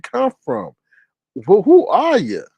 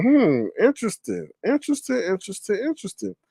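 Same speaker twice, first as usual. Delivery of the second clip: high, softening mock interest, fading; sarcastic musing; 8 semitones higher, 6 dB quieter than usual. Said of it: "And I'm like".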